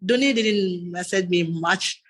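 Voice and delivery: ordinary delivery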